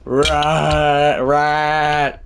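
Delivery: loud, long held shout; frustrated yell. Real, not acted. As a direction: yell each word out, stretched. Yelling, then blames own voice.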